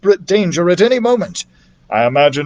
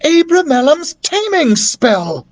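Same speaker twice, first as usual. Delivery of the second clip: excited cry; 12 semitones higher than usual. A narrator's reading. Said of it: "I cried".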